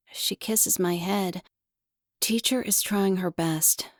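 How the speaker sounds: usual speaking voice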